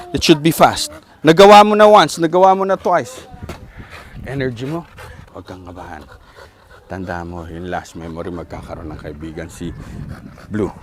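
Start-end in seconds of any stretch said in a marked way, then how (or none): 4.3-10.7 low, soft reassuring words; calm the owner down gently; soothing hush. Real, not acted. Trainer reassuring the nervous owner, hushed and soothing.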